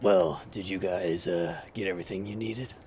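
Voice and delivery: unhurried host checking on guests; voiced game character Justin. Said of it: "And he's like".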